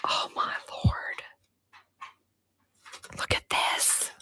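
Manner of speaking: hushed amazement; awed whisper. Real, not acted. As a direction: breathe it out as if in a museum. Hushed awe at old find.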